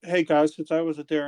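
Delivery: ordinary talk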